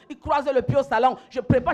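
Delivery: usual speaking voice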